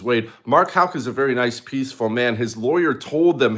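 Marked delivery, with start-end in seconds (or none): none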